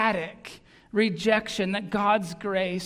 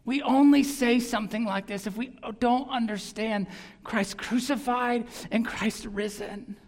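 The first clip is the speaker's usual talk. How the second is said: ordinary talk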